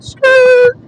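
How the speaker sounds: high, loud mock skid noise; imitated tyre screech. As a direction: screech it like skidding tyres. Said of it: "that lady just said".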